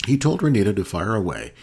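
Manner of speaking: ordinary delivery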